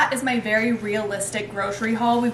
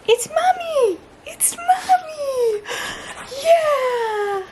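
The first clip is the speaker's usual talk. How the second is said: excited squeal; much slower than usual. Real, not acted. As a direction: squeal at the dog, half laughing, half crying. Dog confused by her mask.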